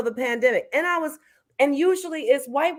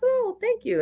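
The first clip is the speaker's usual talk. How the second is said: pleased thanks; 12 semitones higher than usual. Retold or imitated. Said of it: "And I said".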